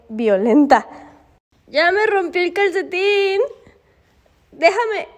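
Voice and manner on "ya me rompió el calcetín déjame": high, exasperated whine; whining complaint. Real, not acted. Woman whose dog tore her sock, whining complaint.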